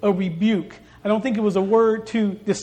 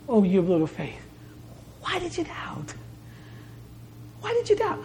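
high, soft and pleading; concerned urging; 5 semitones higher, 6 dB quieter than usual. Retold or imitated. Jesus urges sinking Peter with concern, soft and high. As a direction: plead gently with real concern. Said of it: "in a way of concern and urging".

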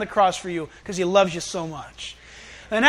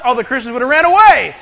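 ordinary talk, nothing marked

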